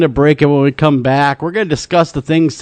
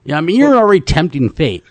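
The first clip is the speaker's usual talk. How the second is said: usual speaking voice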